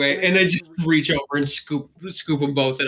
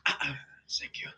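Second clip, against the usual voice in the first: whisper; 12 dB quieter than usual. Acted. Thanking Maha quietly, unvoiced.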